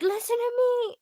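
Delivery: very high, loud, squeaky voice; little chipmunk shrieking at people; squeaky chipmunk yell. Acted. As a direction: yell like a tiny chipmunk demanding attention. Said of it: "I have the squeakiest voice".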